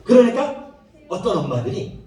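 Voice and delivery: ordinary talk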